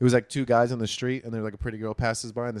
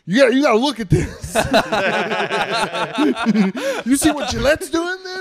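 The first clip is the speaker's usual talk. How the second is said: very high, loud, laughing; high loud laughing excitement; mock excited; 13 semitones higher, 9 dB louder than usual. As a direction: gleefully show her the outrage. Excited to girlfriend.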